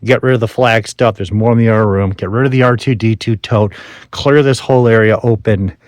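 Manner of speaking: normal talking voice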